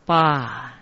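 "巴" as a long open ba, for 罚; pronounced ba. Shown as a demonstration. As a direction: hold "巴" on an open a. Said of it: "这个处罚的罚字念成".

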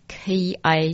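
"ki" with a K, as English K-I; pronounced ki. Shown as a demonstration. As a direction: say "ki" like English letters K-I run together. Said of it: "是像英文K I这样的音".

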